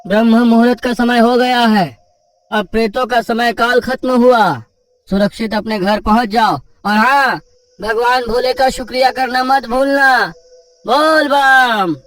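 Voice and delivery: high, ringing send-off; devout rescuer. Acted. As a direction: proclaim that the danger has passed.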